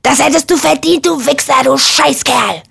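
high, furious stream of abuse; enraged roar. A narrator's reading. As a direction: yell the insults, stretching the last. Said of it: "brüllte er".

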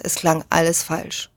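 ordinary delivery, nothing marked

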